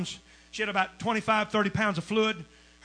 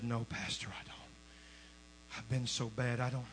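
weak whisper; 9 semitones lower, 12 dB quieter, much slower than usual. Retold or imitated. Voicing sick woman's doubt.

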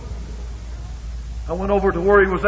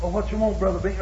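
mock answer; 2 semitones higher, 8 dB quieter, faster than usual. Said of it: "Brother Lane answering softly".

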